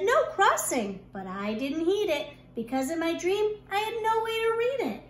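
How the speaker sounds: ordinary delivery, nothing marked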